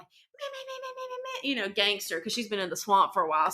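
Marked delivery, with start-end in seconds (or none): 0.0-1.5 very high, soft nonsense meh meh; squeaky mock jabbering; cartoonish high meh babble. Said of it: "going off like".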